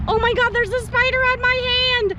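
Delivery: high, loud alarmed blurt; panicking at a spider; startled panic. Real, not acted. Spider lands on her hand.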